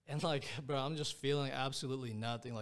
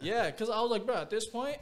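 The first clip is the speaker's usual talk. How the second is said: much higher and louder, fired up; excited; 11 semitones higher, 9 dB louder than usual. Real, not acted. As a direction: burst out all wound up. Desperate peak of the story.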